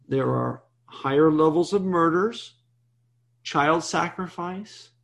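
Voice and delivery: normal talking voice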